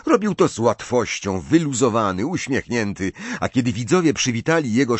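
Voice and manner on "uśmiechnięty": plain reading voice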